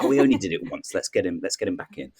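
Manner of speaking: usual speaking voice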